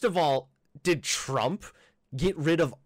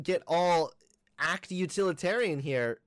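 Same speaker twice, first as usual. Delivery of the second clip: usual speaking voice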